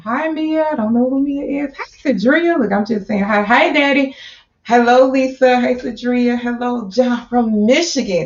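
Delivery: high, bubbly shout-outs to each name; excited greetings. Real, not acted. Host calling out viewers' names in the chat, bubbly. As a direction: beam at every name in the chat.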